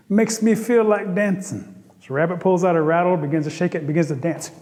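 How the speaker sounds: ordinary delivery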